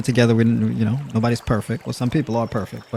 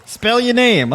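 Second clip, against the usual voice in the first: high mocking quizzer; 11 semitones higher, slower than usual. Acted quizzer asking.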